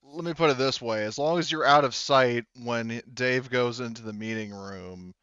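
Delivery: normal talking voice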